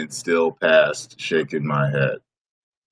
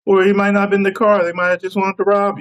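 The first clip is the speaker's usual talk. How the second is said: much higher and fast; 12 semitones higher, faster than usual. Real, not acted. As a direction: rush it up high. Sudden high fast.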